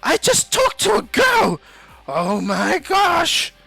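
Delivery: high, drawn-out shouted squeal; hammed-up fanboy screaming; Deku, ecstatic. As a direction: shriek it in giddy disbelief. Deku ecstatic after meeting girl.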